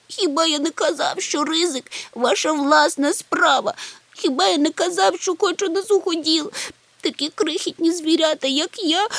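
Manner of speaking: very high, whiny little creature; wailing. A narrator's reading. Chmykh reproaches his companions for the risky trip, wailing. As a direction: keep wailing high, full of self-pity. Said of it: "урвав його голосіння".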